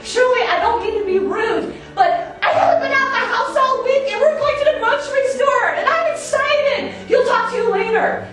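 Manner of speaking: high, loud, rushed apology; apologizing to the old neighbor while buzzing to leave, fast and high; excited apology. Retold or imitated. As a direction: rattle it off, fast and giddy. Tells neighbor they must go.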